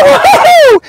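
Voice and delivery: joyful woo-hoo. Acted. Peter floating on the water, whooping in delight. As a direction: whoop like a kid on a ride.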